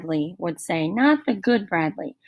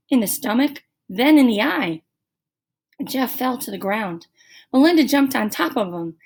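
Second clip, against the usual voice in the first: ordinary delivery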